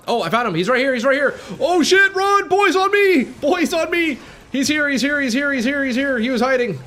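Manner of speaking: high, loud frantic yells; real alarm, calling the team over; panicked shouting. Real, not acted. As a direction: yell it out as the boss jumps you. Streamer spots hidden boss suddenly.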